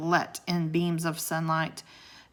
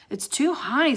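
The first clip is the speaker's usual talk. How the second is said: alarmed; 8 semitones higher, 4 dB louder than usual. A narrator's reading. Jack despairs of reaching crack.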